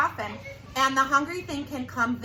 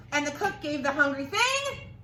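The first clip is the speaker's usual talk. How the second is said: usual speaking voice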